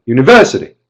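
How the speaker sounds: short "university" with a punched "ver"; quick, forceful "ver" in "university". Shown as a demonstration. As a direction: jab the "ver" in "university" without lengthening it. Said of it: "Try getting louder without going longer".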